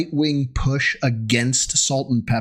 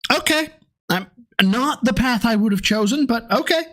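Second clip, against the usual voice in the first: pitched way up, mock-resigned; sarcastic resignation; 8 semitones higher than usual. Real, not acted. Mocking his cohost's lore check, high and resigned. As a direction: sigh it off with fake acceptance.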